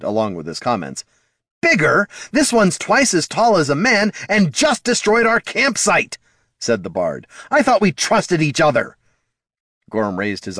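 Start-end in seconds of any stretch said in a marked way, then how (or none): 1.6-6.2 high, loud incredulous protest; indignant outburst. A narrator's reading. Bard protesting to dwarf about the troll, indignant near-shout. 7.5-9.0 high, loud hurt protest; indignant reproach. A narrator's reading. Bard confronting dwarf over secrets, indignant reproach.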